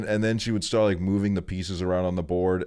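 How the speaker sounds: ordinary talk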